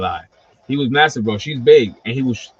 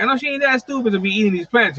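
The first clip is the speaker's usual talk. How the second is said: incredulous; 10 semitones higher, faster than usual. Retold or imitated. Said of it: "I'm like".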